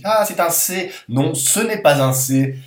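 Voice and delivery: high loud fake ah, c'est un C; mock discovery. Teacher mimicking a student's wrong guess, surprised. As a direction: play the student who thinks it's a C. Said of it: "Je vous déconseille de dire".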